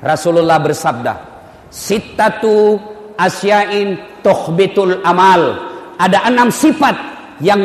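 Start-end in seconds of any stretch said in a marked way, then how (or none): 1.7-5.7 slow, drawn-out Arabic hadith recitation. Acted. Preacher to congregation, slow solemn Arabic recitation. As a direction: chant each Arabic word slowly.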